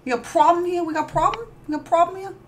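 put-on New Jersey accent, goading buddy; light New Jersey tough-guy taunt. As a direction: lean in, New Jersey style. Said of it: "my Jersey accent".

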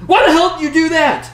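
high, loud furious shout; angry shout. Acted. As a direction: yell it at the killer in outrage. Crew member confronts a killer.

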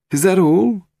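high, rising question; anxious Willie voice. Acted. Suspect to departing policeman, high uncertain question. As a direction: ask it pitched right up.

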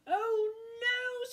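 high squeak of dismay. Acted. Witch dismayed at her friendly pumpkin, squeaking high. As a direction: squeak it out in alarm. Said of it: "squeaked the witch".

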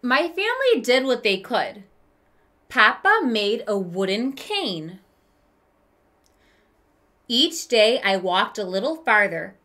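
gentle read-aloud storytelling voice; story narration. A narrator's reading. Teacher reading a picture book to kids, storytelling voice. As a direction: read it like a children's book to the class.

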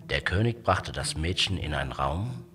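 usual speaking voice

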